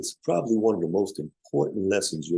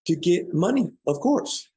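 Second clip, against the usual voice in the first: higher-pitched, lightly voiced woman; woman's answer; 10 semitones higher than usual. Seminar leader retelling a woman's answer, lightly imitated in high pitch.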